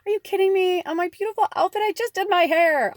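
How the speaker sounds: high, loud vain indignation; be outraged about your outfit and hair; indignant outrage. Pretend. Suitor splattered with mud, loud indignant complaint. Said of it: "And he's like".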